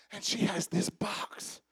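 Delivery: slow unvoiced whisper; hushed narration of the woman's box; storytelling whisper. A narrator's reading. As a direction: whisper it like a secret.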